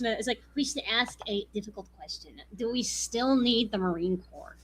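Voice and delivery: lightly voiced nasal-free nerd. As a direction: read it like a nerdy know-it-all. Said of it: "the nerd voice".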